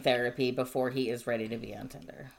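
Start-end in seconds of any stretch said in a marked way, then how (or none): 1.3-2.3 breathy whispered jab under the breath; whispered aside. Real, not acted. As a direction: drop to a whisper for the punchline. Whispered aside about the guy.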